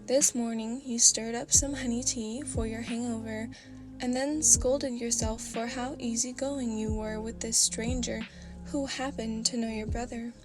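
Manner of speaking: plain reading voice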